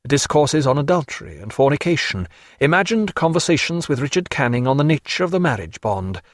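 plain reading voice

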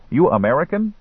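put-on high-pitched boy asking. A narrator's reading.

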